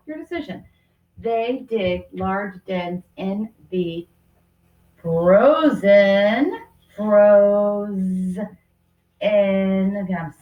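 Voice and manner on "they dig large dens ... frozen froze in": slow, careful reread; teacher rereading slowly as she writes; going slow. Shown as a demonstration. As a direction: read it out slowly while writing. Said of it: "I'm going slow".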